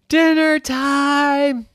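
slow, high, loud drawn-out call. Acted. Mom calling Irwin again, slow loud call. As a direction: stretch the call long.

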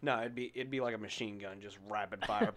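ordinary talk

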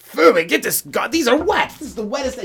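high, loud disgusted complaint; king rejecting wet eggs, high disgusted whine. Acted.

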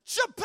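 shouted high "Chapow" like a blow landing; loud high "Chapow" impact shout; chapow sound effect. Shown as a demonstration. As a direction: bang it out like a whip crack. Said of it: "Chapow's the word".

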